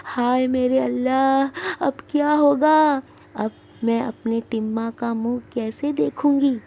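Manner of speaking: wailing lament. Acted. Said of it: "सीने पर हाथ मारती हुई".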